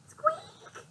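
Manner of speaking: tiny squeaky mouse noise; high-pitched mouse squeak. Acted.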